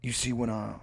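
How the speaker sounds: laid-back drawled tough guy; mock-profound action-star patter; Steven Seagal impression. Co-host lecturing a "player" on mojo, drawled impression. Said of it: "the one he's got now where he's like".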